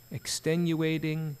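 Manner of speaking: ordinary talk, nothing marked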